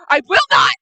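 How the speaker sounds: loud, high defiant outburst; yelled refusal. Acted. Kira heard through the door, loud defiant yell. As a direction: refuse at the top of your voice. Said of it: "Absolute blood curdling, just yelling".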